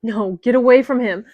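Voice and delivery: desperate shout at the figures. Acted. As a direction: chase them off with a shout. They drag his body away.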